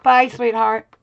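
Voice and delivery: loud affectionate farewell; calling goodbye. Real, not acted. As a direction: call it out to someone leaving. Calling goodbye to someone leaving.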